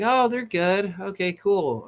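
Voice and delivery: high-pitched mock approval; mock schmaltzy approval. Streamer mocking a schmaltzy talent show, mock approval. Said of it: "it just makes for a schmaltzy show".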